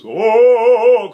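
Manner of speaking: loud, covered baritonal Oh; mock darkened tenor tone. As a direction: push the neck down for a dark sound. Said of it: "started doing this".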